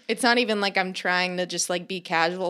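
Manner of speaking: ordinary talk, nothing marked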